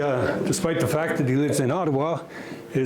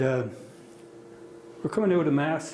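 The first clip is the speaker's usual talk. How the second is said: usual speaking voice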